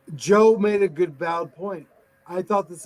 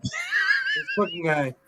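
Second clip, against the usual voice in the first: mock exasperated guy; 2 semitones higher, 4 dB quieter, slower than usual. Mocking Joe's voice.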